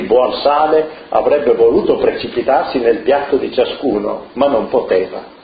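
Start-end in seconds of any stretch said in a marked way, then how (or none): none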